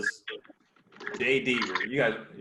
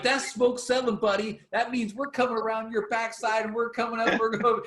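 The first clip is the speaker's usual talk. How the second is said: much higher, cocky play-by-play taunt; imitating Deaver taunting; 9 semitones higher than usual. Deaver warning the opponent we're coming, much higher cocky voice. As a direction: rub it in to the guy in front, high. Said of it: "He's like".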